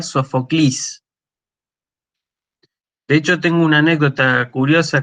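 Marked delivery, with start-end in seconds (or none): 0.0-1.0 Sofoclis with a final stressed EE sound; Modern Greek pronunciation. Shown as a demonstration. Lecturer showing Modern Greek, Sofoclis ending in stressed "klees". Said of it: "hoy en día el griego moderno sería".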